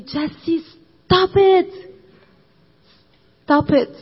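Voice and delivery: loud high yell of stop it; Jesus yelling. Retold or imitated. Jesus rebuking Jesse, yelling loud and high. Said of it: "yelling at me".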